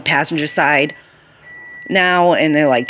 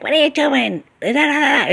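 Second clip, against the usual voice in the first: very high mocking squawk at normal volume; mock yelling; 11 semitones higher than usual. Worker imitating boss yelling about the filing, mock yell. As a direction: mimic a scolding boss, pitched way up. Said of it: "so she yelled at me".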